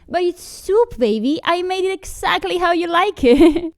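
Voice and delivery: high-pitched pleading sweetheart; coaxing girlfriend. Acted. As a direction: coax your partner to eat, voice pushed up high. Girlfriend coaxing partner to eat soup.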